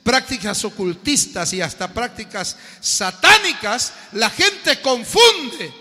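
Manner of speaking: loud, slow, emphatic preaching; preaching at full force. Acted. Preacher driving home the lesson to the congregation, loud and slow. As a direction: hammer the point home to the congregation.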